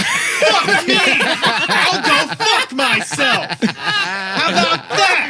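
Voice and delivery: mock outraged yell. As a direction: yell it in fake indignation. Mock outrage at being blamed.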